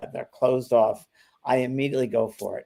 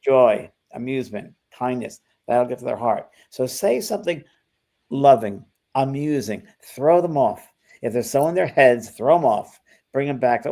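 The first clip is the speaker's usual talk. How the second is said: ordinary talk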